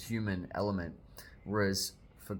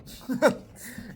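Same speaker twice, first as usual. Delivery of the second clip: breathy giggle; 5 dB louder than usual. Real, not acted. Spoiler laugh.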